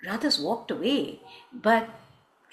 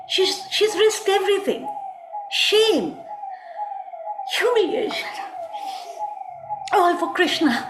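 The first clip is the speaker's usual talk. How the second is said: much higher, aggrieved storytelling; impassioned outcry; 11 semitones higher than usual. Acted. Storyteller pleads Radha's sacrifice, impassioned. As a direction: cry out each loss with high-pitched intensity.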